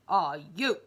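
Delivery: loud, gruff booming demand; cross giant. Acted. Giant to tiny Mister Greedy, cross and booming. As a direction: bark the question crossly. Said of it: "in a cross voice".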